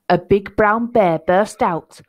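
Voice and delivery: ordinary delivery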